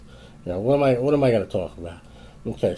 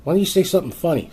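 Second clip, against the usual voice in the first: higher and fast, pushing it again; fast, higher exasperated repeat; repeated angry demand; 7 semitones higher, faster than usual. Retold or imitated. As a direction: rattle the demand off faster. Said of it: "finally I was like".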